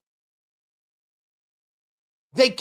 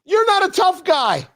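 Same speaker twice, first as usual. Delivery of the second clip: scornful; 8 semitones higher than usual. Real, not acted. Ridiculing Schumer.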